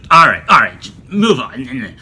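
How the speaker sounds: loud, impatient brush-off, slowing at the end; mock dismissive. Instructor brushing off whatever the student says, mock dismissive. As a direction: imitate someone cutting the student off sharply. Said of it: "she's just being so dismissive".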